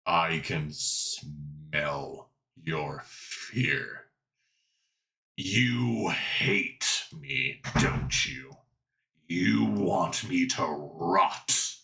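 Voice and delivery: low, slow menacing creature; creepy villain voice. Acted. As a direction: drag out each threat slowly.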